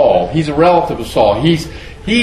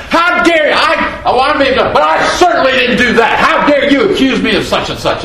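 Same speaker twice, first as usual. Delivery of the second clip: high, loud, offended protest; how dare you, hurt pride flaring; a sinner caught out, bristling at the accuser; indignant outburst; 10 semitones higher, 3 dB louder than usual. Pretend. Acting out indignant self-defence.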